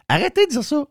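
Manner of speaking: high exasperated plea. Real, not acted. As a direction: cry out in exasperation. Exasperated rant at listeners.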